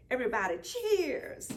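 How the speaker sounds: plain reading voice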